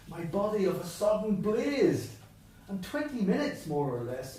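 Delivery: reading the verse aloud, rising with the lines; poetry recitation building to a high bright blaze; poem reading. Acted. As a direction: recite the stanza with growing lift. Reads Yeats poem aloud.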